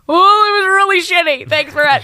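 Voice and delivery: high, loud sarcastic complaint; mock sarcastic outburst. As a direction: snap back sarcastically at the wife. Acted husband sarcastic reply.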